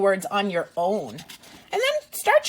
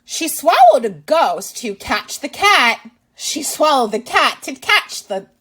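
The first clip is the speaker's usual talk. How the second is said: lively, louder read-aloud voice; reading to children; 3 semitones higher, 7 dB louder than usual. Acted. Teacher reading a picture book to kids, lively and loud. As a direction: read it out big for little listeners.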